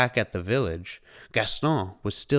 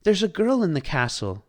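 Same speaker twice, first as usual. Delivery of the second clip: put-on higher, eager child voice; excited child; 9 semitones higher, 5 dB louder than usual. A narrator's reading. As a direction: rush to tell mother. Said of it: "announced Chip excitedly".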